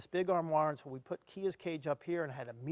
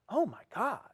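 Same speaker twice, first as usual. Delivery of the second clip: dismayed gasp; 6 semitones higher than usual. Retold or imitated. Parrot shaking in cage.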